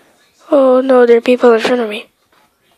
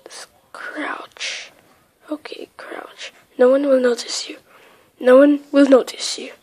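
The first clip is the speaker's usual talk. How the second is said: hushed unvoiced whisper; 5 dB quieter than usual. Real, not acted. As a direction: whisper so nobody hears. Sneaking in hide and seek.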